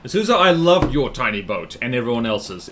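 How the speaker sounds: ordinary talk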